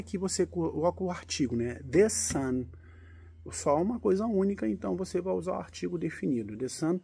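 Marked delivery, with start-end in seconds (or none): none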